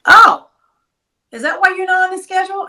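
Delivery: high, quick mock-innocent oh; imitating a man caught out, acting clueless; feigned surprise. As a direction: play dumb in a high voice. Gilbert's fake-innocent reply, retold.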